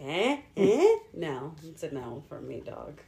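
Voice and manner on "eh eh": teasing. Retold or imitated. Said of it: "you're looking at me like".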